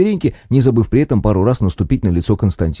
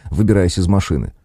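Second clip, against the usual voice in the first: ordinary delivery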